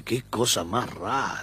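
soft, slow puzzled aside; musing under the breath at something odd; mock puzzled aside. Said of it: "y uno dice". (susurro desconcertado en broma)

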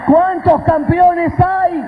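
high, drawn-out preacher's call; rallying call. Real, not acted. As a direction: call out slowly to the whole crowd. Rallying the congregation.

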